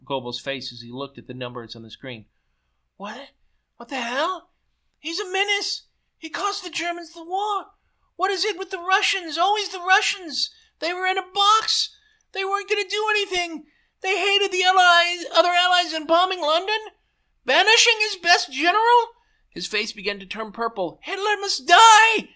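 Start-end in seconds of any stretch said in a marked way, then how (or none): 3.0-19.1 high, loud, screeching rage; furious rant. A narrator's reading. Goebbels rages at the results. 21.0-22.3 high, loud, slow furious yell; raging shout. A narrator's reading. Goebbels in rage, face purple.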